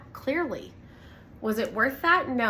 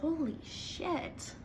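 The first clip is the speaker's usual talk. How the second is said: hushed realization; 6 semitones higher, 9 dB quieter, much slower than usual. Retold or imitated. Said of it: "I was like".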